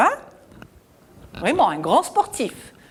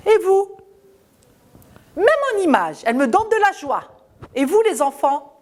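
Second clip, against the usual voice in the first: enthusiastic; 8 semitones higher, faster than usual. Real, not acted. Teacher enthusing to children.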